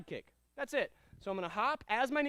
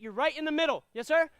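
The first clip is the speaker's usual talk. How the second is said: ordinary talk, nothing marked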